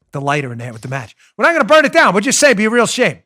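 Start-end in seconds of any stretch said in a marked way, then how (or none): 1.4-3.2 mobster voice. Retold or imitated. Mob enforcer voiced in story.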